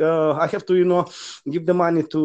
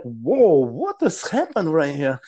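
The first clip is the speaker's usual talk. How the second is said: stunned; slower than usual. Retold or imitated. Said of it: "I'm like".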